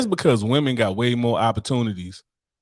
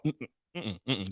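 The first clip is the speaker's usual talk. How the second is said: normal talking voice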